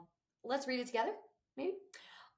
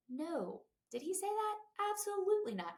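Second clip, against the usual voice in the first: much higher mock outrage; incredulous denial; 11 semitones higher than usual. Real, not acted. To viewers, denying he meant it, incredulous. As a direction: scoff at the very idea.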